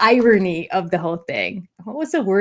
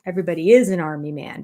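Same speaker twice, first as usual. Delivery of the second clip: ordinary talk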